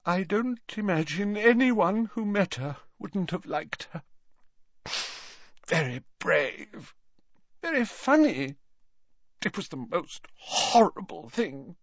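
put-on high, halting old man's grief; tearful. A narrator's reading. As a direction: reminisce high and tearful, with pauses. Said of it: "his eyes brimming with tears once more".

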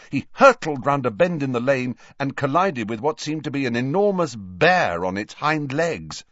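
ordinary delivery, nothing marked

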